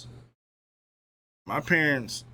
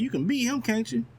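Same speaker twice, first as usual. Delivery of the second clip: much higher, eager questioning parent; hopeful parents; 13 semitones higher than usual. Retold or imitated. Streamer voicing his parents urging him on, much higher. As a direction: coax him like a believing parent. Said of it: "They're like".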